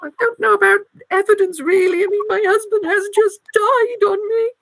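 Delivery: put-on high widowed old lady; a granny lamenting, half-tearful. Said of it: "my old granny voice".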